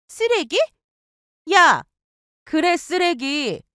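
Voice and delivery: high outraged shriek, then a slow cold echo. Acted. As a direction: spit the word back at her. Outraged repeat of insult.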